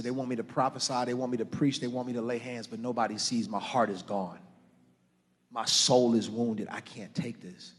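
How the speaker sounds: low, subdued and soft; heavy-hearted, hushed retelling; retold weary confession. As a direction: recount a private hurt quietly. Retelling his own despairing confession.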